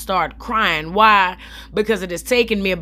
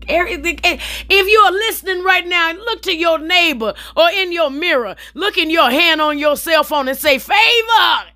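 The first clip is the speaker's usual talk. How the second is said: preaching; 7 semitones higher than usual. Pretend. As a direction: rouse the congregation to shout favor. Preacher-style call to audience.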